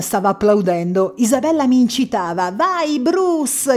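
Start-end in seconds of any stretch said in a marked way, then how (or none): 2.6-3.8 cried out. A narrator's reading. Isabella eggs the narrator on, crying out. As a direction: urge him on with a lifted cry. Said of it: "gridò".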